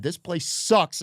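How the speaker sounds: ordinary talk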